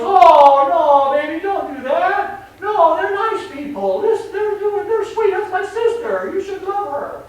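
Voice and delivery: put-on high, loud baby talk to her pet; doting dog mom. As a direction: coo at the little dog like a doting owner. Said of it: "And the mom goes".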